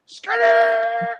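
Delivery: high, loud mock yell; calling out. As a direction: holler it out as a joke. Mock calling out while joking.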